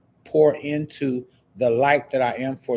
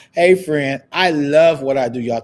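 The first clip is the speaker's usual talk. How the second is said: excited greeting; 3 semitones higher, 7 dB louder than usual. Real, not acted. Greeting a viewer joining live.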